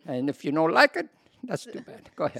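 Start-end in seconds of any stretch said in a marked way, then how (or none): 1.4-2.1 higher, softer alter ego sign-off; odd put-on voice. Alter ego dismissing critics, higher and softer. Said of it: "they want to come out and play".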